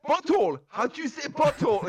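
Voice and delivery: very high, loud exasperated yell; mock furious shout at the teacher; retold angry shout. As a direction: yell the word in frustration. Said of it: "I was getting pissed".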